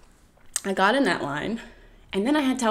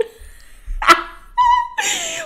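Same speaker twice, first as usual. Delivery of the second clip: high squealing laugh; 3 dB louder than usual. Real, not acted. Laughing at own predicament.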